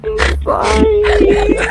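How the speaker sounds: high, loud, slow mock wail; drawn-out wailing apology. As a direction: wail the sorry out, stretched long. Wailing apology for slow music search.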